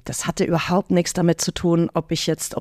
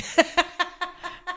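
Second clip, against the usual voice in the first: high giggle; 12 semitones higher, 5 dB quieter than usual. Real, not acted. Joke about sex.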